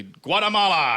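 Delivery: yelling a Jeopardy answer at the TV; yelled answer. Retold or imitated. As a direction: shout the one answer you know. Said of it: "I'll yell it like a".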